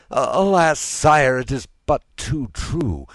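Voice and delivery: high, loud lament; woeful cry to the king; anguished. A narrator's reading. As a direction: confess it in distressed dismay. Minister confirms dreadful news to king.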